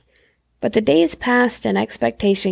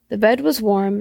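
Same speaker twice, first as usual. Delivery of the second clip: plain reading voice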